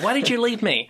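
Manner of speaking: put-on high, loud, whiny teen snapping back at his mom; a lost son snapping at his mom in a high, loud, accusing teenager voice; snappy teenager tone. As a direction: snap it like an indignant twelve-year-old. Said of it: "he snapped at his mom in that teenager tone".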